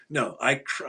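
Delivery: normal talking voice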